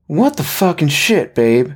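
high-pitched, slow, angry outburst; sincerely angry. Acted. Drunk husband blaming his wife for the spilled dinner, angry. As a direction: pitch it up, drawn out in angry disbelief. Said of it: "he was sincerely angry".

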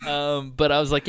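ordinary delivery

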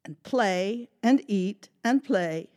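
put-on lively, animated read-aloud for kids; storytime narration. A narrator's reading. Librarian reading a story to children, animated. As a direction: tell it big and bright for little listeners.

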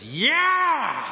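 huge high yelled whoop; retold fiancé bursting into a shout; war cry. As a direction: let out a joyful war cry. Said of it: "war cry that just goes".

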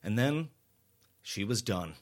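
ordinary delivery, nothing marked

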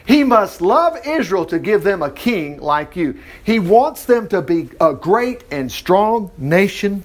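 slow, drawn-out scripture reading; slow, solemn scripture reading. Shown as a demonstration. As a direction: read it slowly, weighty and solemn. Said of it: "Here it is".

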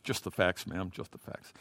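low, quiet, lifeless delivery; just report it, low and toneless; Dragnet deadpan. Acted. Old police show detective to a witness, deadpan. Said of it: "the old Dragnet shows".

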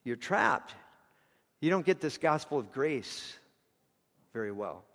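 normal talking voice

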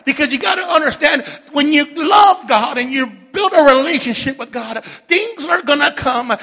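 high, shouted sermon; full-force preaching. Real, not acted. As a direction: drive it home at full volume to the church.

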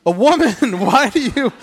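playful laughing take on Jesus' reply; laughing mock retort. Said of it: "Jesus was slapped by his mother".

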